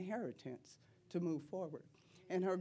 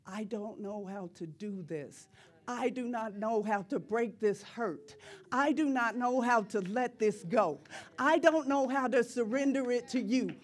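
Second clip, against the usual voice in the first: building prayer plea; 3 semitones higher, 7 dB louder than usual. Acted. Preacher voicing her plea to the Father, building louder.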